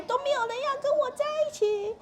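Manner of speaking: very high, put-on upset child voice; 生气的孩子. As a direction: whine it high like a child having a tantrum. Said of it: "所以他生气的时候". (模仿孩子生气时尖着嗓子抱怨)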